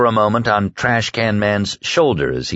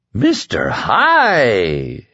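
drawn-out, swooping giggly mimicry; giggling; much slower than usual. A narrator's reading. Man mocks the polite newcomer's phrase, giggling. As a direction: stretch it out in giggly delight. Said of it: "Hector Drogan was giggling".

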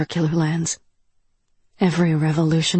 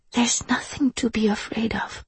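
soft, higher-pitched whisper; 4 semitones higher, 5 dB quieter than usual. A narrator's reading. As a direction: whisper it as reassurance. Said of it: "Duho whispered".

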